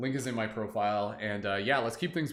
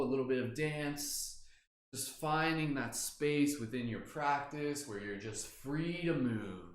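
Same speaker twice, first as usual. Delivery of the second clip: slow, soft meditation voice; guided meditation; 3 dB quieter, slower than usual. Acted. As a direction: draw each word out gently and calmly. Leads a movement meditation.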